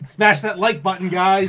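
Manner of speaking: loud hammed-up YouTuber plug; YouTuber outro voice.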